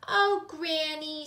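put-on high, slow, drawn-out child voice; sheepish little girl. A narrator's reading. Gilly found inside the box, high sheepish voice. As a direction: stretch out a sheepish appeal. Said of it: "feeling quite silly".

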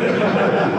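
quick breathy chuckle; short laugh. Real, not acted. Lecturer chuckles after plugging his own notation. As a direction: chuckle at your own jab.

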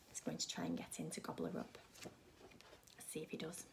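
whispered suspense aside; soft and secret, build suspense; hushed suspense. Acted. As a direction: whisper to the children what might happen next.

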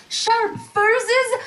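ordinary talk, nothing marked

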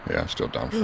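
hushed whisper of the repeated line. Real, not acted. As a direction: breathe it out as an unvoiced whisper. Repeats the room line softly.

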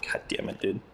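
frustrated whisper under breath; whispered curse. Real, not acted.

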